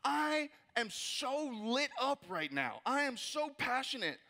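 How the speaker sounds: slow, drawn-out, stretched-out enthusiasm; fired-up declaration. Acted. Attendee walking out of the conference, fired up. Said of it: "you're gonna be like".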